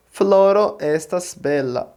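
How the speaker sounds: "Floro estas bela" in slow, careful Esperanto; pronunciation demo. Shown as a demonstration. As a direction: model "Floro estas bela" slowly for learners. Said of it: "Vou pronunciar".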